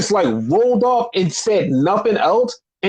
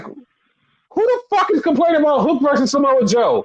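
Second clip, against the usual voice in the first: higher-pitched, exasperated disbelief; incredulous outburst; 5 semitones higher than usual. Retold or imitated. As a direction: ask it like it's absurd, voice raised in pitch. Incredulous reaction to a tweet.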